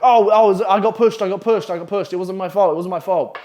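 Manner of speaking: loud, high mock-frantic protest, easing off; frantic excuse. Boy caught by staff protests his innocence, frantic. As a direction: imitate someone babbling excuses, starting loud.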